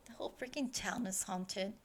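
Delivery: normal talking voice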